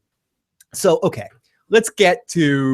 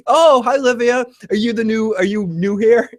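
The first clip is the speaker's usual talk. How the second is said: much higher, brightly cheerful greeting; cheery resident; 7 semitones higher than usual. Retold or imitated. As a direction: sing out a friendly hello. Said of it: "And goes".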